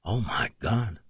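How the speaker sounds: stunned, hushed whisper. A narrator's reading. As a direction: whisper it in disbelief. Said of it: "Jake whispered".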